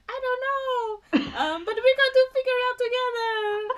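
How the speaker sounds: high, far, teary falsetto; hammed-up crying girl cat; mock girl cat. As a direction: whine it like a weepy cat. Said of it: "a girl cat, just like".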